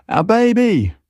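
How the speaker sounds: high anguished cry; screaming. Acted. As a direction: cry out for the child in terror. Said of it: "Gemma screamed in my head".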